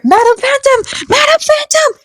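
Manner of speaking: high-pitched ghostly calls from afar; calling out high. Acted. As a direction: shout her name in a high ghost voice.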